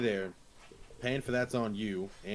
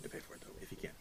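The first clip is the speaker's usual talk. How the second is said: whispered aside; 15 dB quieter, much faster than usual. Real, not acted. Drops to whisper.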